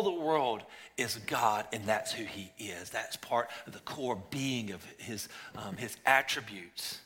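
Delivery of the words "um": ordinary delivery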